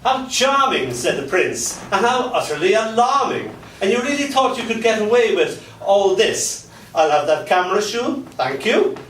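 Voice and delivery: a hint of a posh menacing prince; lightly voiced gloating prince; prince's voice. Prince gloating as he shoots Cinderella, mock menace.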